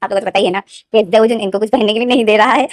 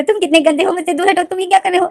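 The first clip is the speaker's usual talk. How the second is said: higher, whining protest; high protesting whine; 7 semitones higher than usual. Acted. To Wing, shoving him away: high whine. Lenden scolding Wing in bed.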